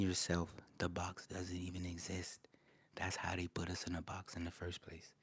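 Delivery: hushed secret whisper. Real, not acted. Telling the chat a secret, whispered. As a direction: lean in and whisper it. Conspiratorial whisper about boxes.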